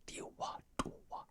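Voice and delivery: whispered, no voice at all; unvoiced whispered tongue demo; silent mouthing. Shown as a demonstration. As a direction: shape the syllables silently, only breath. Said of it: "it's no vocals".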